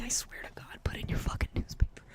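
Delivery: fast whispered threat; rapid whispered threat; menacing whisper. Acted. Taunting the newspaper.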